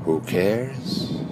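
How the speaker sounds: slow, drawn-out meditation phrases with long pauses; guided meditation. Shown as a demonstration. Guide leading listeners through three breathing phrases, slow meditative delivery. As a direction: intone each question and answer slowly, leaving room to breathe.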